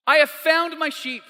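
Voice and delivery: high, excited announcement in one run; rejoicing. Acted. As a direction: announce the good news in one quick phrase. Repeats the rejoicing at quicker pace.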